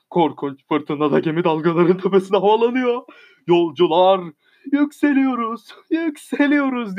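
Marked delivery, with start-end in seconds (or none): 4.7-7.0 high, joyous cry of passengers; joyful shouting. A narrator's reading. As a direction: cry out with joy as the ship rises. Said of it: "diye sevinçle bağırıyorlar". (sevinçle, yüksek sesle bağırış)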